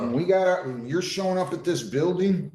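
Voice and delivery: a judge losing patience, voice climbing; frustrated. Real, not acted.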